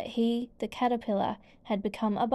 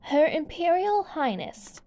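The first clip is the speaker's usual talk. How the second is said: much higher proclaiming announcement; herald's call; 6 semitones higher than usual. A narrator's reading. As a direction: announce royalty to the court.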